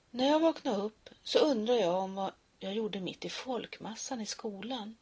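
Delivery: ordinary delivery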